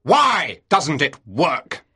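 captain furiously questioning his officer; furious shout. A narrator's reading. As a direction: hammer out each word in anger. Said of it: "shouted Bligh furiously".

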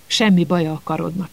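ordinary delivery

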